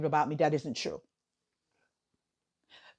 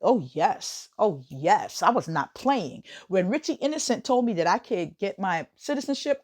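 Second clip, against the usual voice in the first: ordinary delivery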